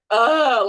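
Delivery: a loud, half-sobbed groan of despair; sobbing groan. Retold or imitated. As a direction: let out a wailing ugh. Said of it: "like a sobbing mess".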